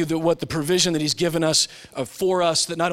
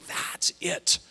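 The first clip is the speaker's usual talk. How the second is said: whispered awe; 4 dB quieter than usual. Pretend. Mystery lover finding the solution, hushed whisper. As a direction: whisper it in quiet wonder.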